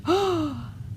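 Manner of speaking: a sharp high gasp; crowd gasp. Acted. Crowd watching the dragon crash down, sharp gasp. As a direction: gasp in shock as the dragon falls. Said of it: "The crowd gasped".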